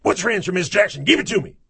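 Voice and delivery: very high, forceful shout at a pupil; acted in-your-face classroom yell; shouting teacher. Shown as a demonstration. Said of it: "by shouting at her".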